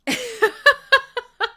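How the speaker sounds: real laugh, high and loud. Real, not acted. Host cracks up reading the chat, high laugh. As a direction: crack up loudly.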